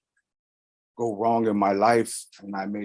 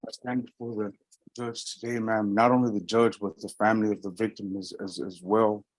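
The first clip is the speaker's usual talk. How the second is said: more projected, audible statement; speaking up. Real, not acted. Defendant repeating statement to judge, speaking up. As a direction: raise the voice enough to be heard. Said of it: "but you're whispering".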